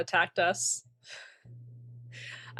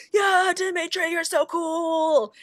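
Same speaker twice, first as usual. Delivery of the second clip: mock crowd cheer; 8 semitones higher, slower than usual.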